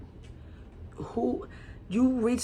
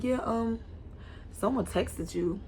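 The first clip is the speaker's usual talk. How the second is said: calm quiet tone; 2 semitones higher, 9 dB quieter, slower than usual. Retold or imitated. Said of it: "I was real calm".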